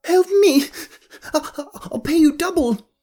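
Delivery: desperate shriek for help; panicked begging. Acted. Rivari begs Kaya, on knees.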